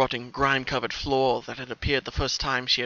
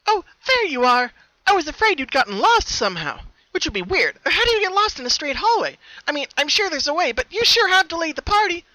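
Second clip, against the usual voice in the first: much higher and louder, bubbly and sing-song; a too-cheerful host scolding playfully; creepy party-girl brightness; other Pinkie voice; 9 dB louder than usual. A narrator's reading. Other Pinkie greets her.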